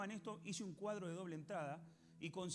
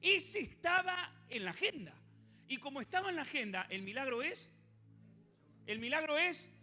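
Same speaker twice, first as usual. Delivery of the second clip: preaching at full force; 6 semitones higher, 7 dB louder than usual. Real, not acted.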